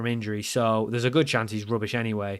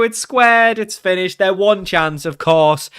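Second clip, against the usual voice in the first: louder, higher cry of dismay; dismayed commentary; 6 semitones higher, 8 dB louder, slower than usual. Real, not acted. Player watching opponents score their one chance, dismayed. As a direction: call the goal against you in exasperation.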